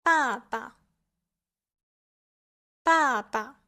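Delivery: falling first 爸, short second 爸; fourth tone. Shown as a demonstration. Teacher giving Chinese examples, falling fourth tone on the first 爸. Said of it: "the first character has the fourth tone".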